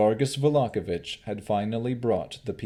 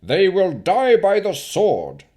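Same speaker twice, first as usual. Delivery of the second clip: high, loud, slow threat; menacing decree; 10 semitones higher, 10 dB louder than usual. Acted. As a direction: pronounce the sentence. Baron threatens execution.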